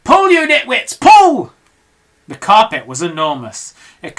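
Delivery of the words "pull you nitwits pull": loud high yell of pull; commanding shout. Acted. Mugglewump urges the animals, loud shout. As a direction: shout the crew into action. Orders the crew.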